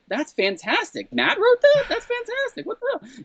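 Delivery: very high squeaky laughing disbelief; hammed-up falsetto amazement; mock astonishment. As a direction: squeal it like a stunned fan, then fade off. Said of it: "and I was like".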